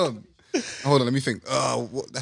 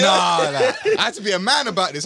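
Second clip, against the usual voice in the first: loud, high retelling through a laugh; animated self-quote, pitched up; excited self-quote; 9 semitones higher, 6 dB louder than usual. Retold or imitated. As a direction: recount it loudly, hyped up. Excited retelling of own reasoning.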